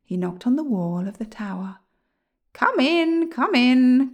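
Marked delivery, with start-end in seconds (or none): 2.5-4.1 high, loud call from inside; crying out. A narrator's reading. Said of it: "cried a voice".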